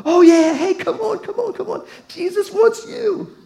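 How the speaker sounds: hammed-up crowd suddenly eager for the beggar; fawning crowd. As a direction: gush like a crowd that switches sides. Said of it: "those kind of people".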